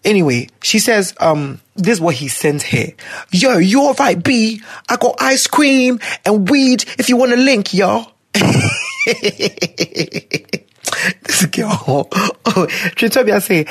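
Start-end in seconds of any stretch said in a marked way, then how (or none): 3.3-8.1 imitating a London roadman accent. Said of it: "Me London Roadman accent". 8.3-10.6 very high shrieking laughter. Real, not acted. Laughing at the roadman text.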